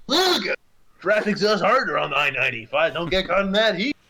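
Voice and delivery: loud, high radio huckster pitch; used car salesman. Pretend. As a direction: sell it like a sleazy car dealer on radio. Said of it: "how good [name] is at the used car salesman impression".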